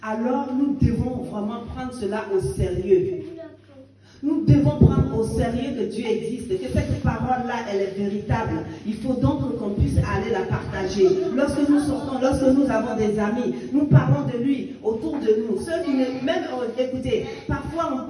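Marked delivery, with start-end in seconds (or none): none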